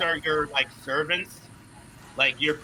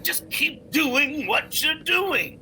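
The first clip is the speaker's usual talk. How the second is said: slow mock encouragement; 8 semitones higher, slower than usual. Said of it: "He's like".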